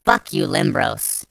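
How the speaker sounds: usual speaking voice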